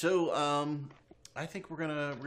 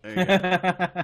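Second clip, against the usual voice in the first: laughing; 8 semitones higher, 6 dB louder, much slower than usual. Real, not acted. Laughing repeat.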